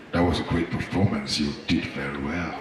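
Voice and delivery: low, heavy voice for the big guy; deep man voice. Retold or imitated. Big guy Michael complimenting him, in a deep voice. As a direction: drop the voice way down for Michael.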